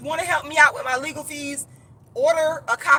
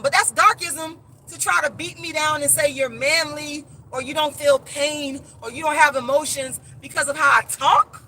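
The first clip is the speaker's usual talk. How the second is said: ordinary talk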